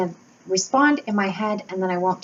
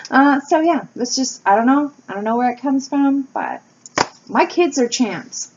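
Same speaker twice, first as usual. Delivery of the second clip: ordinary delivery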